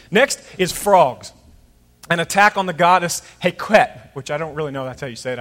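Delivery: ordinary talk, nothing marked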